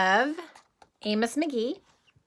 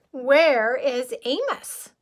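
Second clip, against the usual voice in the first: slow, worried question; wondering; 4 semitones higher, 6 dB louder, much slower than usual. Acted. Zoo animals wondering where their friend is, slow worried question. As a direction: ask it slowly, puzzled and concerned. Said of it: "the animals wondered".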